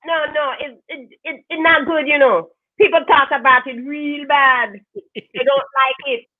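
badly faked Jamaican accent, drawn out. Shown as a demonstration. Asked to show the cohosts the accent, mock Jamaican accent. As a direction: do a poor Jamaican accent, slowing down. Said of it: "now I have to hear the accent".

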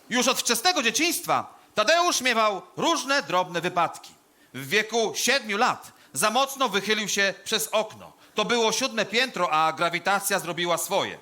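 loud, high, declaimed eulogy; hammed-up graveside orator; pompous funeral oration. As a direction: proclaim each phrase with grave ceremony and long pauses. Delivers a funeral speech.

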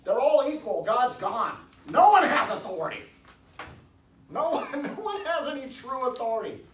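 high, loud proclamation; forceful preaching. Real, not acted.

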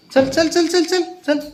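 very high, sing-song coaxing to the pet; pet-coaxing call. Real, not acted.

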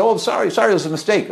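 high, hurried mock apology; a hint of sheepish rancher; apologetic ranchers. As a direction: play the caught rancher begging off lightly. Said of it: "And they said".

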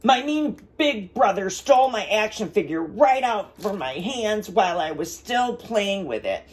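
put-on high, whiny child's voice; a hammed-up tearful child rattling off troubles; upset little boy. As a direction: pour out the woes as a sad kid. Crying boy tells his woes.

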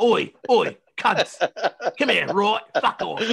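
put-on super hard pikey English accent; guttery English accent. Podcaster imitates Tom Hardy as Bond, guttery English accent. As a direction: play a rough English hard man. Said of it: "super hard, guttery English accent".

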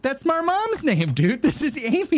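high-pitched, delighted mock reveal; excited high joke. Joking to friend that the creature is his mom, pitched high and excited. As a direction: squeal it out like you found family.

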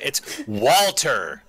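WALTER drawn out slowly and proudly; respectful emphasis on WALTER. Shown as a demonstration. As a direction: stretch out WALTER with weight. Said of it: "put some respect in the name".

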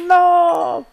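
very high, very loud scream of no; shrieking no as the loot is lost; real anguished scream. Real, not acted. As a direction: scream it in despair. Spider drops no eye.

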